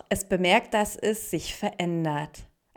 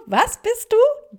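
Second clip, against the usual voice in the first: very high, loud, drawn-out question; mocking disbelief; 8 dB louder, slower than usual. A narrator's reading. Water plants laugh at the lily, high mocking question. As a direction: squeal it slowly in ridicule, rising at the end. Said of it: "da lachten die Wasserpflanzen".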